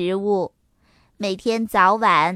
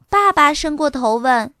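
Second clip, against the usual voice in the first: ordinary delivery, nothing marked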